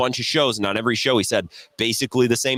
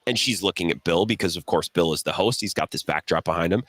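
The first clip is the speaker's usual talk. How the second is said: normal talking voice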